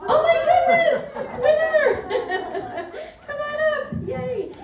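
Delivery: high, loud thrilled cheer; excited shout. Real, not acted. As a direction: call the winner up with delight. Raffle winner found, calling out.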